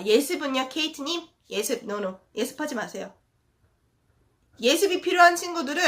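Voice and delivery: usual speaking voice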